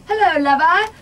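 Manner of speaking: loud, high mock English accent; put-on English accent. Shown as a demonstration. American guest showing radio host her bad English accent. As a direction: belt out a hammy English accent. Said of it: "You can try doing an English accent".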